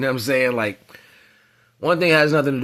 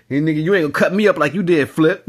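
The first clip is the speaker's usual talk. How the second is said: loud, fast, higher angry retort; defiant threat; 5 semitones higher, 6 dB louder, faster than usual. Retold or imitated. [name] confronting [name] in the club, loud angry defiance. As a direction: spit it back at him, fed up. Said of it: "That's how [name] was thinking".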